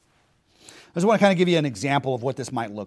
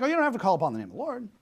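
mocking; 4 semitones higher, 6 dB quieter, much faster than usual. Mocking opponents' claim.

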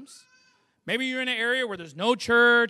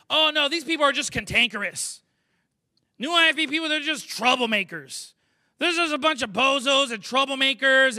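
mock indignant critics; 3 semitones higher, 6 dB louder than usual. Voicing critics dismissing the newcomers.

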